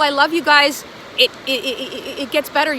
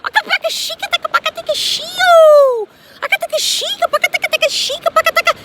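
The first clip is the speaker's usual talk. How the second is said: high, loud chanted gibberish syllables; channeled light language; 7 dB louder than usual. Acted. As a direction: chant nonsense syllables high and fast like a possessed caller.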